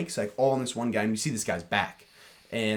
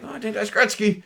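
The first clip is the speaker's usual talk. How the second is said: higher, excited recognition of a star; a retold gasp of recognition, pitched up; starstruck surprise; 9 semitones higher than usual. Retelling spotting a hockey star, excited surprise. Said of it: "And I'm like".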